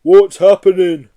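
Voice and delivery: loud, slow, high wail; wailing Yeti. Acted. Yeti stuck in revolving doors, loud slow wail. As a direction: wail it like a bewildered big beast. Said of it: "wailed the Yeti".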